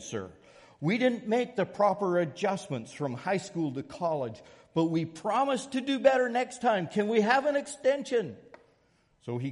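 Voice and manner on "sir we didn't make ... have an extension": pleading students. Retold or imitated. Students begging their professor for an extension, high pleading voice. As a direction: beg the professor like sorry freshmen. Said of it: "they said".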